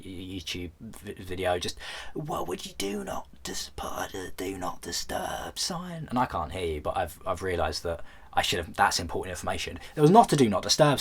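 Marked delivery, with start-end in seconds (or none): 2.1-6.1 mock heckling viewers. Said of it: "people are all shouting".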